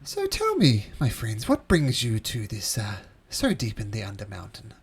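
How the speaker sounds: lilting host greeting that swoops down into the question; inquiring host. Acted.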